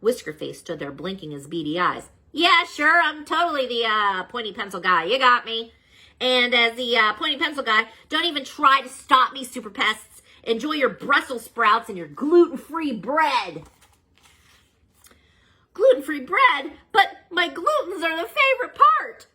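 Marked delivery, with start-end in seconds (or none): 2.3-13.6 higher, louder mock agreement; sarcastic villain. Whiskerface mocking. 15.7-19.3 high, louder whiny dismay with a laugh; comic wail. Acted. Laugh and cry heard.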